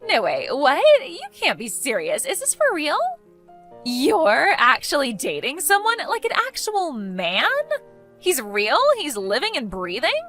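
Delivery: theatrically astonished; incredulous shock. Acted. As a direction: marvel in disbelief at every word. Cannot believe she has a man.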